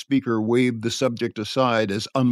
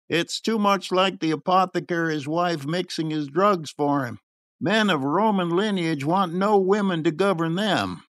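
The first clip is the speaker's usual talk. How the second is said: higher, puffed-up pronouncement; 6 semitones higher than usual. A narrator's reading. Puffed-up man airing views to companions, self-important. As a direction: hold forth as a pompous man.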